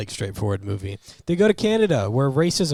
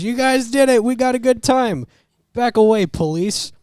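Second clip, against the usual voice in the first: high, loud triumphant coach yelling; cheering coach; 11 semitones higher, 6 dB louder than usual. Retold or imitated. Coach John Candy bursts in on the police, high and loud in triumph. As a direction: yell it like a coach whose team just won.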